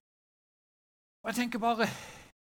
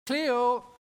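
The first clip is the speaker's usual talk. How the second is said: loud, higher searching call; long, raised call into the woods; 5 semitones higher, 7 dB louder than usual. Retold or imitated. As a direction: shout the missing child's name. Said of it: "så begynner hun å rope".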